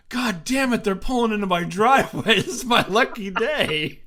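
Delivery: loud, high, gleeful cursing that breaks into a laugh; excited shout. Retold or imitated. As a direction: yell it like the luckiest guy alive. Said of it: "He's like".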